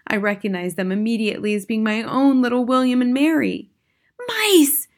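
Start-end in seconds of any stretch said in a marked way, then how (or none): 4.3-4.9 very high, loud squeal; shouted. A narrator's reading. Witch audience seeing the mice, high shout. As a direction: shriek it like an excited crowd. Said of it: "shouted the audience".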